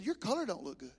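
a hint of a worried wife; lightly voiced wife. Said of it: "She said".

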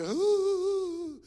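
high, loud hooting "ooh"; mimicking folks spooked by the preacher, hooting; mock fearful ooh. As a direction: imitate scared churchgoers. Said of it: "the people go".

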